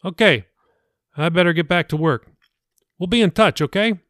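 a hint of a cheerful pastor; pastor Billy. The pastor says goodbye to Jacob, lightly voiced and friendly. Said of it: "Pastor Billy said".

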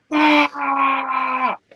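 sudden high, loud cry of frustration; retold scream. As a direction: yell it out in angry frustration. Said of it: "I just screamed, and I was like".

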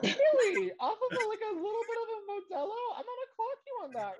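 high, incredulous teasing; teasing disbelief. Real, not acted. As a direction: squeak up in mock disbelief. Teasing friend about getting tipsy.

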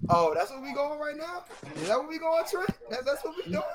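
high, loud disbelieving questions; indignant surprise. Real, not acted. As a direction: call him out in disbelief. Indignant at friend's trade.

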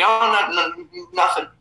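retold stammering evasion, starting high; hesitant, broken-up brush-off. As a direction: hedge and stumble over the answer. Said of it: "I was hesitant".

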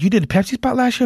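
high, quick mock surprise; surprised question. Friend asking about last year's Pepsi spot, surprised. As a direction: imitate a friend's incredulous question, fast and high.

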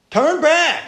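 high, loud cry of turn back; loud warning. Acted. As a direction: shout it after them down the street. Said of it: "telling every time they walk up there".

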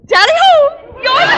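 loud, high tally-ho whoop; hunting cry. Acted. Hostess cheering her guests on like a hunt, loud whoop. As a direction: whoop it out like a gleeful fox hunter.

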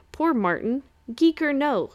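ordinary delivery, nothing marked

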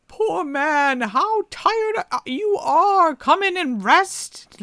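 put-on old woman tempting the tired man; coaxing old woman. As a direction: lure him in like a witch.

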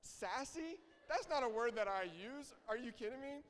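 high-pitched comic incredulity; hammed-up indignant protest; mock disbelief. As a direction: balk loudly at your own word choice. Mock disbelief at his own word.

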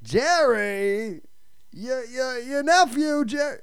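lightly voiced nagging old uncle; a hint of Leo pestering Jerry. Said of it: "more sounds like Leo".

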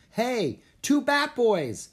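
high, loud, drawn-out call; excited shout to passers-by; kid's shout. A narrator's reading. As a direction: holler like a kid across a park. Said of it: "one of the kids shouted".

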